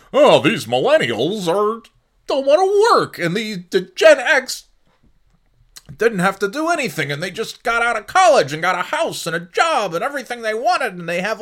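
put-on high whiny caricature of an older complainer; mocking boomer voice. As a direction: sneer it like a grumpy boomer.

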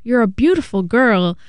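a hint of an eager old man inviting her to dinner; old man asking her out. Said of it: "And he's like".

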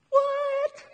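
high, squeaky "What?"; mock shock. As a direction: feign disbelief with a big jump up.

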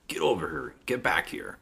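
high gruff "get over here"; Scorpion voice. Retold or imitated. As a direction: imitate Ed Boon's Scorpion line. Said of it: "he does Scorpion's voice".

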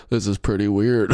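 low, bored magician deadpan; uninterested David Blaine. Acted. David Blaine with an ice pick through his arm, low deadpan. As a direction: say it low, as if nothing matters. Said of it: "he just seems so uninterested in everything".

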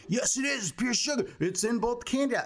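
high-pitched mock wife jumping back in; high, insistent comic retort. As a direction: argue back in a high, put-on voice. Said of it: "my wife would jump back".

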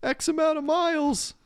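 squeaky sarcastic whine; whiny high voice. Retold or imitated. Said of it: "it's like".

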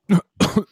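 sudden coughing fit, like choking. Real, not acted. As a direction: cough hard as if something went down wrong. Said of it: "Uy, que me ahogo".

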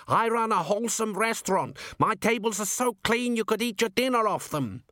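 high-pitched protest without real volume; indignant shout. A narrator's reading. Accused cook defends his clean restaurant, indignant mock shout. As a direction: protest your good name, pitched high. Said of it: "shouted Gimlet from somewhere".

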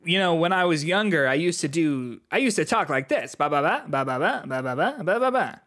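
lilting stand-up impression, pitch swinging up; John Mulaney. Podcaster mimics the comedian's old way of talking, lilting impression. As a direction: bounce through it in a sing-song comic voice. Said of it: "that's my Tom Mulaney impression".

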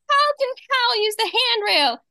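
put-on high, exasperated kid; mock annoyed brother; imitating a fed-up boy, high; Arthur's voice.

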